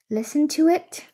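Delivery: usual speaking voice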